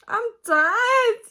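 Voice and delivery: loud, high cry of exhaustion; mock weary wail. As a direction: wail it out, hammed-up. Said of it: "probably not the Southern American accent".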